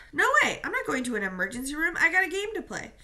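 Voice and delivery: loud, high, defiant refusal; stubborn teen yelling back at his coach; angry protest. Acted. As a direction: refuse it loudly, voice climbing. Victor refuses the hospital.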